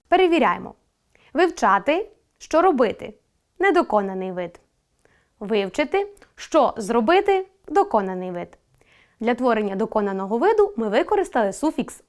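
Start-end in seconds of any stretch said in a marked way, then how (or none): none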